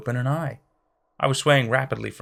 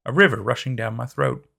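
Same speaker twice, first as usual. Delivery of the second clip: usual speaking voice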